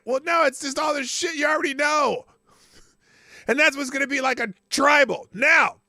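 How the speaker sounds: loud, high-pitched ranting; worked-up rant. Real, not acted. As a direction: get fired up and rant. Rant keeps building.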